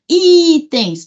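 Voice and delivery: IIItens, I high, loud and long; calling the word. Shown as a demonstration. Teacher checking the accent, calling the word. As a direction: call out Itens, punching and holding I.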